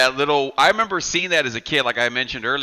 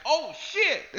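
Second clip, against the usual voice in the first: high, thin little "Oh, shit" from the back; squeaky mock Cenobite; 13 semitones higher, 7 dB quieter than usual. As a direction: squeak it out like a tiny scared monster. Podcast hosts act out a movie.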